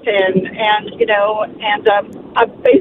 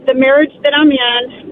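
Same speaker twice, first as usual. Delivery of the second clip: ordinary talk, nothing marked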